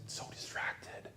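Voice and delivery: slow, breathy whisper. Retold or imitated. Quoting Kayla to the church, slow whisper. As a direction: let it barely escape.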